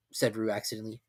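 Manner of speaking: ordinary delivery, nothing marked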